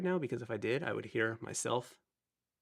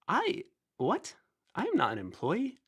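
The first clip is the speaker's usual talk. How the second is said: surprised; 8 semitones higher than usual. Real, not acted. Surprised by a chat comment.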